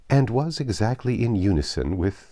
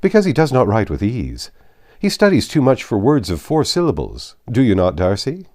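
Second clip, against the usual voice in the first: put-on louder, higher, hearty young gentleman ribbing his friend; hearty teasing; 4 semitones higher, 8 dB louder than usual. Bingley ribbing his friend Darcy in front of the ladies, louder and cheerful. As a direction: tease him with a broad grin in the voice.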